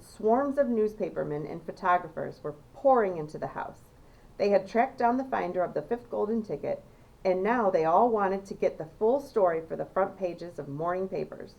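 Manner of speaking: ordinary delivery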